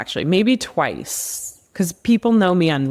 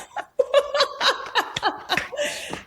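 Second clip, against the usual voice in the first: breathy, giggly laugh; laughing at herself; airy giggle after the story; real laugh; 5 dB quieter than usual. Real, not acted. Laughs after the joint quote.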